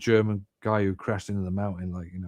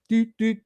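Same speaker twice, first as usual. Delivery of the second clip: high mock keypad tones, pitched way up; imitated keypad beeps; 11 semitones higher than usual. Pilot locked out of the cockpit, mock keypad beeps. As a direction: sing out the door code beeps.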